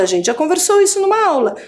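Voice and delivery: normal talking voice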